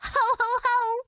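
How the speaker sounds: high, laughing mock Santa ho ho. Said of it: "ghost of Christmas present".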